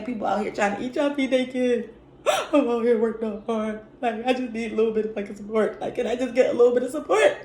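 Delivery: high-pitched, hammed-up begging; mock pleading. Vlogger jokingly begging viewers for support, mock pleading. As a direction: beg for support in a fake desperate whine. Mock plea for likes, then "for real though.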